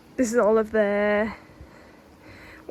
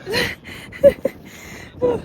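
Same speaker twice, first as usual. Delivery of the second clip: airy laugh; 13 semitones higher than usual. Real, not acted. Laughing at the wine.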